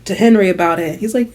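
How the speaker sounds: ordinary talk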